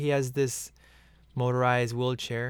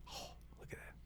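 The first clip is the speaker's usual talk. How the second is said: whisper; 25 dB quieter, faster than usual. Real, not acted. Whispered aside during scene.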